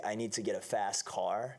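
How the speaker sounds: slow American accent, flat A in "fast". Shown as a demonstration. Actor demonstrating for the interviewer, American accent. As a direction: say it American, with a full R in "car". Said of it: "Et américain, c'est".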